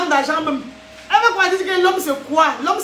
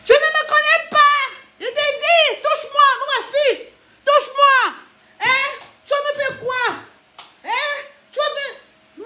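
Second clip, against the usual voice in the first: put-on defiant angry girl; 8 semitones higher than usual. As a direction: dare him to touch her.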